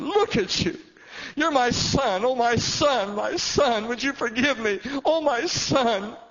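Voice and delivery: high, loud, wailing and crying; tearful cry. Retold or imitated. Father begging his son's forgiveness, crying out loud. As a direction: pour out a father's grief and joy.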